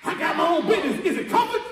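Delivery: shouted, rousing sermon cadence; preaching at full force. Real, not acted. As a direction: preach it hard to the congregation.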